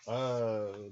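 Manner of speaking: ordinary talk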